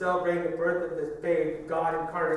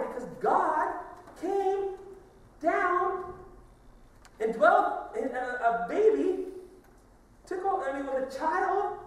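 much higher and louder, drawn out; preaching climax; 13 semitones higher, 6 dB louder, much slower than usual. Real, not acted. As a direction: proclaim each word slowly. Preaching climax, slow and high.

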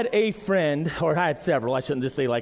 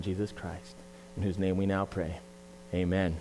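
lower, softer prayer voice; opening prayer; 8 semitones lower, 5 dB quieter than usual. Acted. Pastor praying for the church before the sermon, hushed and reverent. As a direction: pray reverently over the gathered church.

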